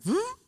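high whooshing door sound; imitated whoosh. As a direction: make the door's vroom.

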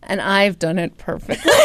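ordinary talk

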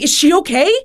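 retold alarmed question, high and loud; freaking out. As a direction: ask it in mock panic, voice jumping up. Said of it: "he starts freaking out".

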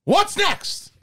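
high-pitched movie-star rant in separate bursts; Al Pacino. Acted. Actor argues with his director over casting, high Pacino impression. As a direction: climb high in mock indignation, dip, rise again. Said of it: "Pacino just went from being like that really high voice".

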